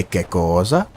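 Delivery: confused Italian. Retold or imitated. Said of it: "if I'm confused".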